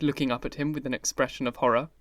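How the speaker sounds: ordinary delivery, nothing marked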